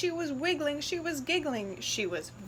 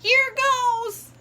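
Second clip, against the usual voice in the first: much higher, loud yell; excited yell; 12 semitones higher, 9 dB louder than usual. Acted. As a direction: shout it as you leap.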